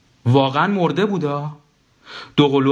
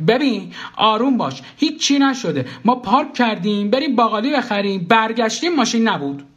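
high, urgent explanation; high-pitched, pleading reassurance; urgent placating; 10 semitones higher than usual. Acted. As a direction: talk down someone panicking. Hurriedly calming a panicking wife.

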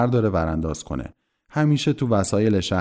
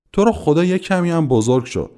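raised, high-pitched protest; exasperated; 8 semitones higher, 5 dB louder than usual. A narrator's reading. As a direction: snap at a roommate's silly clowning. Ackley fed up with the clowning.

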